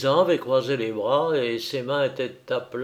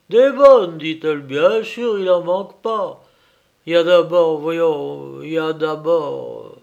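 higher, loud peasant woman's voice; farmwife's confident exclamation; 3 semitones higher, 8 dB louder than usual. Acted. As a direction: exclaim it as a sure-of-herself farmwife. (fermière sûre d'elle, qui s'exclame)

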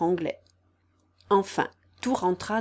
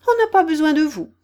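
fast, high, loud dismissal; indignant storybook mouse cutting in; offended rebuff; 8 semitones higher, 9 dB louder, faster than usual. A narrator's reading. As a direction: brush off angrily, quick and pitched up.

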